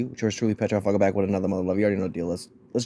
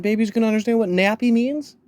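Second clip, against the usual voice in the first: high mocking question; 10 semitones higher than usual. Mocking the presenter.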